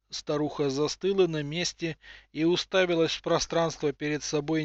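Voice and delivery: plain reading voice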